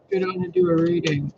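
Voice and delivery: high, lilting spirit-message voice; mock message voice. Quoting the late-night message in a high voice.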